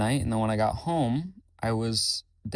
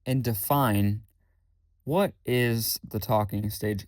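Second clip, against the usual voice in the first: slow; slower than usual. Retold or imitated. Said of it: "I thought".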